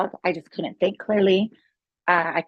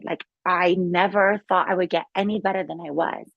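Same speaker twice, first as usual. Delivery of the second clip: ordinary talk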